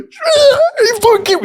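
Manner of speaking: very high, loud mock howl; imitating tearful outrage. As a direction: howl it like a furious man held back. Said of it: "welling up".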